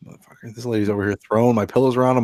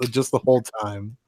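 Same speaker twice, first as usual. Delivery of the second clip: usual speaking voice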